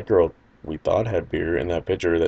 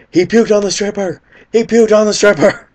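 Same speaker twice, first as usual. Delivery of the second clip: much higher and louder, yelling the news; excited shout; 13 semitones higher, 9 dB louder than usual. Retold or imitated. Friend yelling to the car that Brett puked on the stripper, excited shout. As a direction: blurt it out in shocked glee. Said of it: "he goes".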